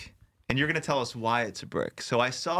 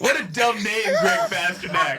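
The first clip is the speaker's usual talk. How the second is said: mocking; 8 semitones higher, 5 dB louder than usual. Mocking the name Greg.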